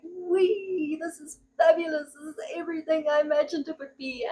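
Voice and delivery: giddy wee of a first jump, voice lifted high; gleeful freefall. Retold or imitated. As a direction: play it like the thrill of a skydive. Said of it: "you're like".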